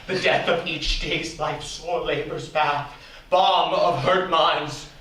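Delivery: ordinary delivery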